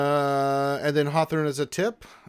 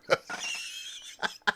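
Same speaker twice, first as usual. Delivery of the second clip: voiceless, breathless laughing; helpless wheeze after a big joke; wheezing laughter; 6 dB quieter than usual. Real, not acted. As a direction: laugh till no sound comes out. Cracks up after shouted quote.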